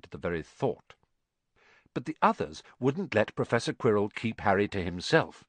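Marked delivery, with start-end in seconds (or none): none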